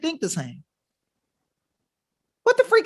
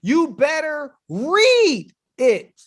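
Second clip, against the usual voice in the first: slow, drawn-out "You better read it"; slow, menacing emphasis on "read"; slow warning; 3 semitones higher, much slower than usual. Shown as a demonstration. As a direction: spell it out slowly, each word heavy. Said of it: "repeated warning".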